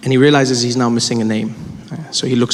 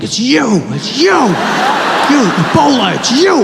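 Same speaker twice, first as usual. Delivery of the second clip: high-pitched mock hysteria; panicked accusation; 8 semitones higher than usual. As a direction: point the blame in a rising, squeaky panic. Opposite passenger accuses him, acted.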